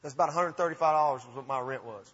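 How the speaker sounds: usual speaking voice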